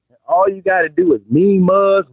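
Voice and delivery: normal talking voice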